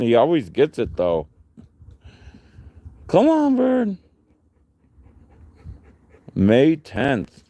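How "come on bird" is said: excited calling. Real, not acted. Calling dog to play.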